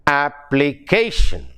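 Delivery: "Application" in separate syllables, strong "ca"; measured ap-pli-CA-tion; stress on penultimate syllable. Shown as a demonstration. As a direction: lean on "ca" in "Application". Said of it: "the stress is on the penultimate syllable".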